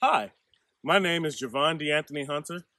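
usual speaking voice

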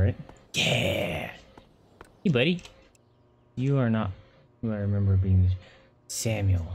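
ordinary talk, nothing marked

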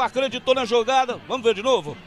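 ordinary delivery

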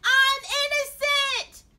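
high, drawn-out shout of protest; devastated cry. A narrator's reading. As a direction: wail it at full volume. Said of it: "cried Darren, devastated".

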